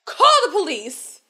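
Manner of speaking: Lukey yelling back, furious; angry shout. Retold or imitated. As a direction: throw it back at her. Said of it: "And Lukey's like".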